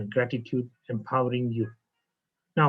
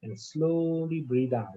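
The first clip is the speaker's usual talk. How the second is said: guided meditation. Acted. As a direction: breathe them down into calm, one phrase at a time. Leads a guided breathing meditation.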